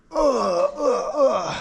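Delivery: effortful grunts mid-rep; straining grunts. Real, not acted. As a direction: grunt through the lift.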